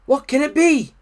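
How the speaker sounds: high, loud anxious question; alarmed Frog. A narrator's reading. Frog hearing the animals' warnings, alarmed. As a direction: ask it in startled storybook wonder.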